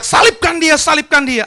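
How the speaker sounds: mock crowd yell. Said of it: "orang banyak itu teriak".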